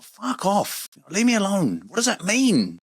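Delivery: high-pitched exasperated brush-off; mock indignation. Acted reply to monetizing requests.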